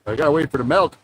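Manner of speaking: put-on Newfoundland accent. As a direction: lay on a thick Maritime Newfoundland twang. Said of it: "It's a unique Canadian accent".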